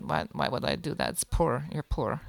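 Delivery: put-on a light vague European accent, half Russian. Imitating the scammer's vague European accent to friends, lightly done. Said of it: "completely borrowed that voice or whatever accent".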